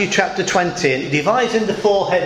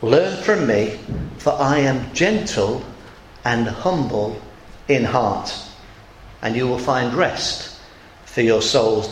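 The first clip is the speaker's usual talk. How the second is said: gentle quoting; 5 semitones lower, 3 dB quieter than usual. Retold or imitated. Preacher quoting Jesus' invitation, gentle and soft. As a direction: speak Jesus' words gently and softly. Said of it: "He says".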